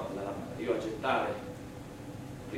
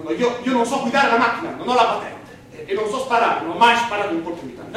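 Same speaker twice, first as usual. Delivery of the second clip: loud, much higher pleading denial; desperate shout; 10 semitones higher, 13 dB louder than usual. Acted. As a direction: cry out the innocence in desperation. Desperate denial before the court.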